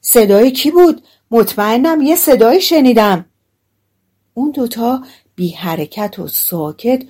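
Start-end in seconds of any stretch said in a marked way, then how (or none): 0.0-3.2 raised, higher voice with mild alarm; a touch of startled fear; frightened. A narrator's reading. Thief who heard an unknown voice, mildly alarmed. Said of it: "با ترس و لرز گفت".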